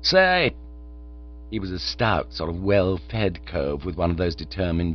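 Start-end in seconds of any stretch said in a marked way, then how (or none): 0.0-0.5 higher, louder blurted interjection; sudden call-out. Acted. Brooding businessman interrupting the group, sudden higher call-out. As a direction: break in to grab attention. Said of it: "suddenly gave tongue".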